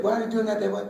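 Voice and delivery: put-on high, pleading child's voice; child questioning dad. Retelling childhood, voicing himself asking dad. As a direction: ask like a confused little kid. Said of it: "we was shoot questioning Dad".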